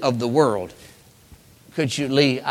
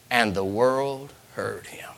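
hushed whisper; 4 semitones lower, 8 dB quieter than usual. Acted. Reading Joseph's weeping to the church in a whisper. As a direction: whisper the last words of the verse.

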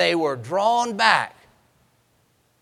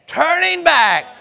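preached call; 9 semitones higher, 6 dB louder than usual. Shown as a demonstration. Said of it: "Let's say it again".

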